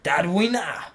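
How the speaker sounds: loud, exaggerated German-accented name. Streamer playing with a character's name, mock German accent. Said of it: "say it with a German accent".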